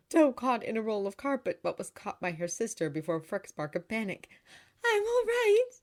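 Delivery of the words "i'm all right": very high, loud, happy exclamation; happy cry. A narrator's reading. Girl caught after tripping, happy cry. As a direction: call out happily that you're fine. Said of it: "she cried happily".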